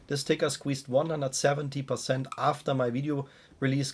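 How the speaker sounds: ordinary talk, nothing marked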